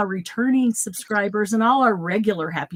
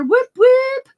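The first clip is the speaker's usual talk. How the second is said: high, loud whoop whoop; excited cheer; 7 dB louder than usual. Real, not acted. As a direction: cheer like a hype host. Cheering a viewer arrival.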